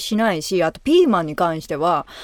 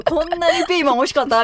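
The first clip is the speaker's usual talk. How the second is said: amazed reaction; 7 semitones higher, faster than usual. Retold or imitated. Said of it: "みたいな".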